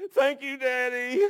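put-on high, slow little-girl cry; imitated crying child. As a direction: imitate a sobbing toddler thanking her father. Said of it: "she would be crying, and she'd do this".

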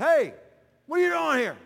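shouted high and loud across the lot; calling out. Retold or imitated. From the crane, calling out to the casino-goer. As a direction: yell over to him by the casino.

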